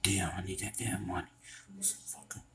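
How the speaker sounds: soft, quick angry whisper; desperate debtor whispering alone; frustrated whisper. Acted. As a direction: hiss it to yourself.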